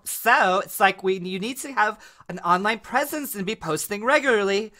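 put-on high, perky-paced tutorial girl; how-to video girl. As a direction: pitch it up like a social media girl. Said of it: "it's either that girl".

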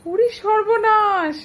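high, slow mock alarm; surprised. Real, not acted. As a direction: gasp in playful dismay.

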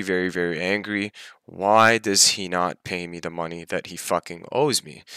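ordinary talk, nothing marked

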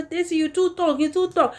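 high-pitched mimicked complaint; complaining critics. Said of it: "people go dey talk say".

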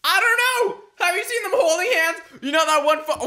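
high and loud, bursting out; excited outburst. Real, not acted.

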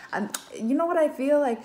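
ordinary delivery

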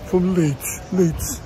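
loud, high mock northern English accent. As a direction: shout it like a northern English lad. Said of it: "Can't even do a northern accent".

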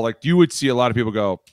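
usual speaking voice

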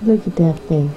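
hushed doting voice; tender cooing. Real, not acted. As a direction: coo it softly like a goodnight to a little one. Mother cooing tenderly to child.

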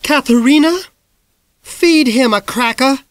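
high, loud holler from another room. A narrator's reading. Miss Greenglass hollering from the hall, loud. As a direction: call it down the hall. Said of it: "hollered back from the hall".

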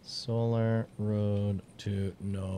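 slow, low dramatic title read; slow announcer voice. Acted.

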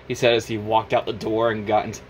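plain reading voice

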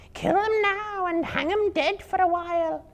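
a vicious spider demanding the kill; hissing spider. Acted. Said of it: "hissed a fourth".

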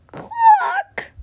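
high clucking squawk; hen cluck. A narrator's reading. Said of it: "she said".

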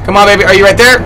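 ordinary talk, nothing marked

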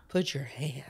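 breathy mock seductive whisper. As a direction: whisper it close and intimate. Mock seductive sleep talk whisper.

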